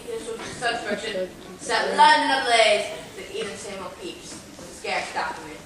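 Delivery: ordinary talk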